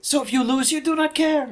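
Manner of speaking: very high put-on Spanish accent; mock Spanish-accented guru, pitched right up; Jodorowsky's Spanish accent. Joking counsel to a losing lawyer, high Spanish accent. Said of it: "we're gonna do the accent".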